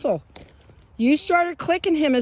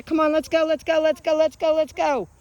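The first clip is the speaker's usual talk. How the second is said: quick, louder, higher cheer-on; urging on; 4 semitones higher, 3 dB louder than usual. Real, not acted. Trainer urging dog toward handler, urging on. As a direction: chant them along eagerly.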